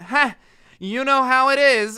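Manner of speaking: loud, high mock laugh and dismissal; mocking brush-off. Wife brushing off husband's request, mockingly loud.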